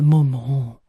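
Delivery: low grumbled imitation of a boy; grumbling. Son hugs his mother reluctantly at the station, grumbling. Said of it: "en ronchonnant".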